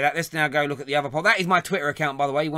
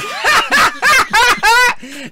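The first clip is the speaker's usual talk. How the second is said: loud burst of laughter; 11 dB louder than usual. Real, not acted. Host cracks up at troll.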